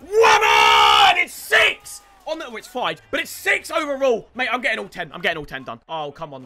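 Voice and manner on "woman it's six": goal celebration shout. Real, not acted. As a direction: roar the goal home.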